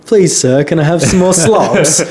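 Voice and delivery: lightly voiced Oliver Twist plea; Dickensian orphan. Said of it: "is it Dickensian".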